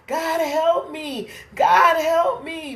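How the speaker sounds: high, slow pleading cry; crying out to God. Retold or imitated. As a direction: plead upward, slowly. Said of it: "he's crying out to God".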